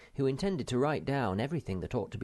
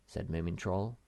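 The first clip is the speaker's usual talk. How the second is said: usual speaking voice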